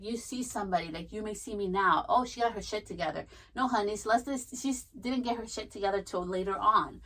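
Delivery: ordinary delivery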